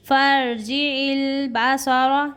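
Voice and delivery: slow, high chant; Quran recitation. Shown as a demonstration. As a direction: draw out फर्जी इल Basara. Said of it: "आधे अलिफ के बराबर खींचेंगे".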